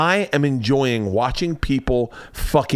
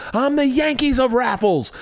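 1 mock boasting; 10 semitones higher than usual.

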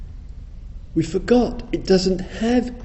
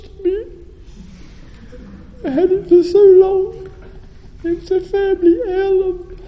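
high, hammed-up crying lament; theatrical wail. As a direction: wail over the lost heirloom. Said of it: "Excuse the theatrics".

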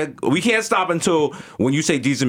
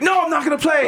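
high defiant refusal; 13 semitones higher than usual. Retold or imitated. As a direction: refuse at the top of the voice. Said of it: "and he's like".

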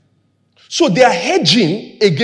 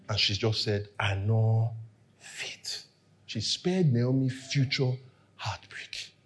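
drops to a near whisper, much softer; hushed; 11 semitones lower, 14 dB quieter, slower than usual. Real, not acted. Preacher hushes as he tells of Orpah sparing Naomi, whispering. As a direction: let the words sink to a breathy hush.

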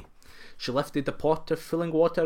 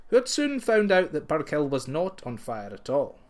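ordinary delivery

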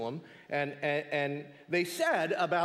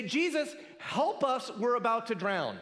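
higher, pleading cry for help; a higher panicked plea in the storm; mock panicked plea; 7 semitones higher than usual. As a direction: play the frightened disciples begging for rescue. Disciples' imagined panicked plea.

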